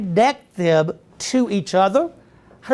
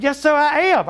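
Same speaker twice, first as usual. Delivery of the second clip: proud reply; 5 semitones higher than usual. Retold or imitated. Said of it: "I was so proud".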